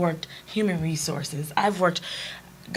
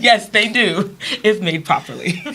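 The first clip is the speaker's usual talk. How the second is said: louder, laughing comeback; answering the boss with a laugh, in triumph; laughing retort; 4 semitones higher, 5 dB louder, slower than usual. Real, not acted. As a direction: toss it back through giggles, triumphant.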